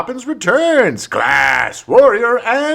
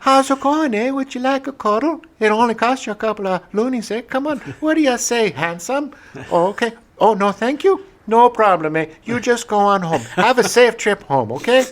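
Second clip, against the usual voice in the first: put-on much higher, sweet Canadian accent; friendly street worker in Quebec, higher Canadian accent; imitating sweet Quebec hooker; 7 semitones higher than usual. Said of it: "the nicest prostitutes I have ever encountered".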